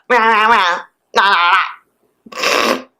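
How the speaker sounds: mock nagging yowls in quick strings; wordless ranting imitation. Pissed woman ranting at him, imitated as wordless yammering. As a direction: mimic her rant as meaningless meows. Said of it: "literally, not even speaking words".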